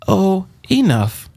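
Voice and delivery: high, slow, sugary reply; drawn-out sweet old lady. A narrator's reading. Old woman slowly reassuring Percy about the light. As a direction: stretch it out sweetly, hiding menace. Auntie Em dismissing Percy's worry.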